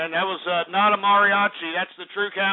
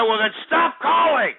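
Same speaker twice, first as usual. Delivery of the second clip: raised, drawn-out irritated yell; annoyed shout; 7 semitones higher, 4 dB louder than usual. Real, not acted. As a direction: yell it higher, stretching the words. Host snaps at repeat caller.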